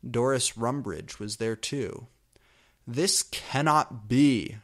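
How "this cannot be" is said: angry shout. A narrator's reading. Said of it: "she shouted angrily".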